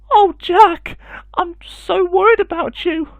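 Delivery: sobbing mother. Acted. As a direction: cry it out in relief and worry. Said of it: "sobbed Jack's mother".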